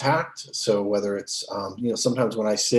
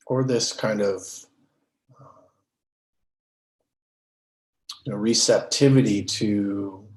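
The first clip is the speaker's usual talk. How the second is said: measured, settled meditation-teacher voice, leaving space between phrases; guided meditation. Real, not acted. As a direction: guide them unhurriedly into stillness. Leads a meditation.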